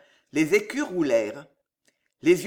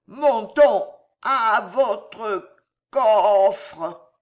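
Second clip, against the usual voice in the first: put-on slow, higher, stretched-out drunken boast; tipsy insistent nephew; drunk Gillot; 4 semitones higher, 5 dB louder, much slower than usual. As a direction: stammer and drag the words greedily. Drunk nephew demands gold.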